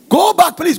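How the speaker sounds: sharp, high, loud command; an angry mother throwing her daughter out; mock shouted order. Mother sends daughter home.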